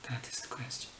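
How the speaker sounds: breathy whispered punchline. Acted. Whispering the Hamlet line to the viewers. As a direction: whisper it like a secret.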